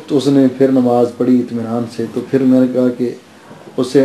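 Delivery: normal talking voice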